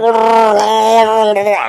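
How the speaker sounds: long, loud, high wordless Chewie roar; Chewbacca imitation. Friend asked for the Chewie thing, mock Chewbacca roar. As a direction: do a drawn-out Wookiee bellow. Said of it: "Can you do the Chewie thing".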